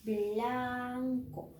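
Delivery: "Blanco" with a long held first syllable; sounding out "blanco". Shown as a demonstration. Teacher links B L to the word, sounding it out. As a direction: blend B into L and stretch "Blanco". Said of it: "B L".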